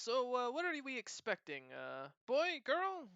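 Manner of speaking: high, bright curious question; curious kitten voice. Acted. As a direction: ask eagerly, voice jumping up. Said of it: "looks at you and smiles".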